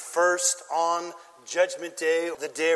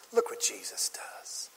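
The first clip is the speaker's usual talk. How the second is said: hushed whisper; 9 semitones lower, 9 dB quieter than usual. Real, not acted. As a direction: drop to a whisper. Dramatic hushed aside.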